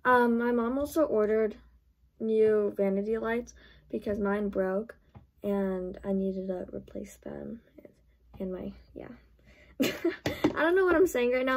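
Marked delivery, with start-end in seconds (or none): 5.4-9.1 whispering. Real, not acted. Trails off, suddenly whispers.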